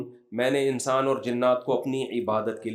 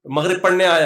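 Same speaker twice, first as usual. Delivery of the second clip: ordinary talk, nothing marked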